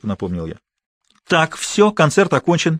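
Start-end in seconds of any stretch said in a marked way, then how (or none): 1.3-2.8 put-on higher, commanding older sister; brusque sister ending the scene; bossy older sister. A narrator's reading. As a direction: take charge and cut them off. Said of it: "перехватила инициативу старшая сестра".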